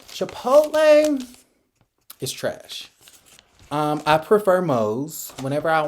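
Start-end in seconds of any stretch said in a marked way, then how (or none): 0.1-1.3 high, drawn-out sing-song name; mock dramatic. Dramatic mocking repeat of name. 2.2-2.9 whispered aside. Pretend. Verdict dropped after the mock announcement.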